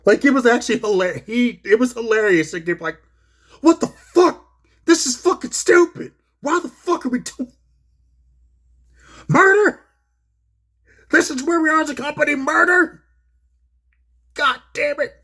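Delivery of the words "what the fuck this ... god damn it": put-on channel an old announcer fed up on air; imitating Jim Ross losing it. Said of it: "he, he lost his shit".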